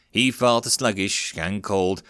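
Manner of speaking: ordinary delivery, nothing marked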